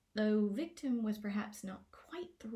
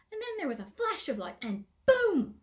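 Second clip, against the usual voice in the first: excited boom; 8 semitones higher, 9 dB louder than usual. A narrator's reading. Gnome woman recounting explosion.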